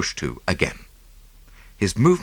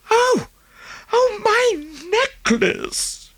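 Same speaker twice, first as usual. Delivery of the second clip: joyful cry; much slower than usual. A narrator's reading. Wife sees her necklace found, crying out high with joy. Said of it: "With a cry".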